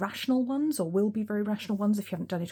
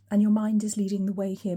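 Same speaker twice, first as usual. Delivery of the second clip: ordinary delivery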